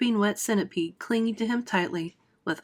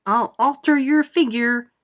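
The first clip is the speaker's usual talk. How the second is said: higher, louder mocking snigger; sniggering peach; 4 semitones higher, 6 dB louder than usual. The peach mocking Aunt Spiker, sniggering loudly. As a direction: snigger it at her. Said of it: "with a snigger".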